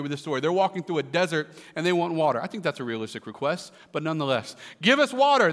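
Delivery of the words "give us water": high, loud demanding cry; mock wail. A narrator's reading. Thirsty people complaining to Moses, mock wailing. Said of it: "they wailed".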